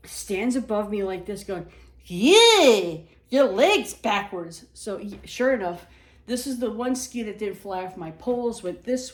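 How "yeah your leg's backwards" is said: loud, drawn-out New York yell; New Yorker lady. Retold or imitated. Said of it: "heavy-set New Yorker lady".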